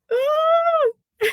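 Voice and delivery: very high, loud squeal; excited squeal. Real, not acted. Host overjoyed at treasure for cousin, excited squeal. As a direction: let out a delighted high squeal.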